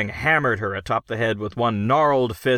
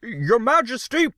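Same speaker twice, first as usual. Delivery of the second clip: high, nervous plea; raised, unsteady voice; 14 semitones higher, 3 dB louder than usual. A narrator's reading. Dry-throated Crockett appealing to His Majesty, nervous raised voice. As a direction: raise the voice, trying to keep it steady. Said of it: "He raised his voice, trying to keep it steady".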